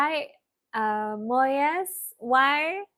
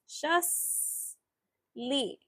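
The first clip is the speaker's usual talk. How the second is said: "Just Lee" as two drawn-out, separated syllables, softer; slow syllables; 2 semitones higher, 9 dB quieter than usual. Shown as a demonstration. Teacher finishing the spelled-out word, slow soft syllables. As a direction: finish softly with "Just", a gap, then "Lee".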